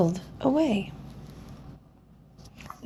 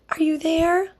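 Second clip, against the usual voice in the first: calling out; 7 semitones higher than usual. Acted.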